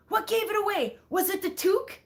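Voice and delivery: high, eager voice quoting the Canadian; excited tourist. Retold or imitated. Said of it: "he was like".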